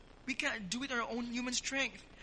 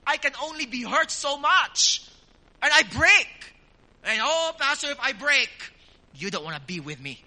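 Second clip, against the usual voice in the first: loud, high-pitched acted protest; protesting churchgoer; 5 semitones higher, 11 dB louder than usual. Hurt believer objecting to the pastor, loud acted protest. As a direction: cry out in hammed-up exasperation. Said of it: "of course, in our minds".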